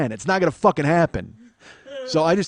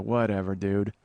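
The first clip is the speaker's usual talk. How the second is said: low, bored brush-off; dismissive; 6 semitones lower, 3 dB quieter, slower than usual. Retold or imitated. Comic brushing off the yelling man, low and bored. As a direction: roll your eyes through it. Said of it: "I go".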